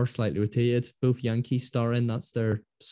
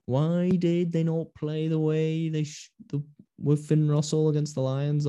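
lightly voiced outraged article; mock headline; 5 semitones higher than usual. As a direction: read it like a smug newspaper critic. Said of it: "there'll be articles everywhere".

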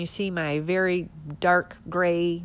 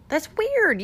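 higher, louder mock amazement on weird; mock surprise; 12 semitones higher, 3 dB louder than usual. Real, not acted. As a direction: exaggerate the fake shock. Sarcastic repeated remark.

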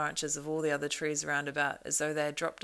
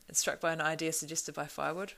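plain reading voice

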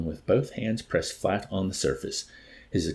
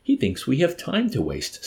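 higher-pitched impatient scoff; snorting scorn; 9 semitones higher than usual. A narrator's reading. Major about the stalling chaplain, snorting scorn. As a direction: scoff at the waste of time. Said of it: "snorted the major".

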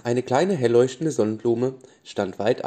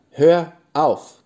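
loud, high, energetic command; emphatic cry, each word punched; more forceful call; 6 semitones higher, 10 dB louder than usual. A narrator's reading. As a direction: shout it firmly to stop someone. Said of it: "rief die Blume energischer".

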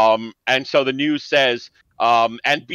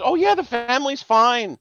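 Yenta voice; 11 semitones higher than usual. Retold or imitated. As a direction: chirp it like a Yenta. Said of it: "making her sound like a Yenta".

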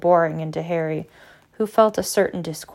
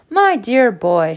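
plain reading voice

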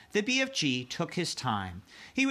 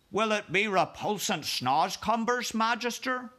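giant's voice; 9 semitones higher than usual. Acted. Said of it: "the B F G asked".

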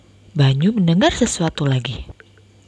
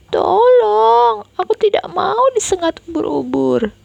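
high loud scared cry for help; a terrified child yelling help; frightened scream; 13 semitones higher, 4 dB louder than usual. Acted. Boy afraid of jellyfish screaming to sister, frightened. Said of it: "teriak Banyu".

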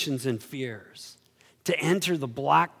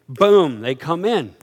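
ordinary delivery

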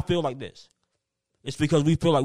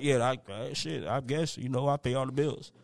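reluctant mumble; 4 semitones lower, 9 dB quieter than usual. Retold or imitated.

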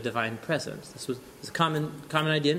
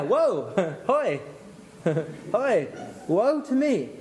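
hammed-up cry of woe; 5 semitones higher, slower than usual. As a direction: wail like a prophet undone, played big. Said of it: "I cried".

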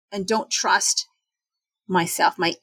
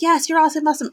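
pep talk; 7 semitones higher, 3 dB louder, much faster than usual. Retold or imitated.

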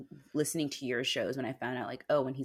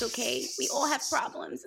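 high, whiny mocking voice; mocking whine; 10 semitones higher than usual. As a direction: whine it like a brat. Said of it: "It's like".

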